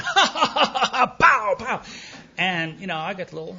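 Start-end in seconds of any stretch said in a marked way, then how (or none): 0.0-1.8 high, booming hammed-up guffaws; uproarious mock laughter. Sponsor pounding his back, laughing loudly. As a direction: imitate a huge belly laugh with thumps. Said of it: "pounding my back and laughing, going".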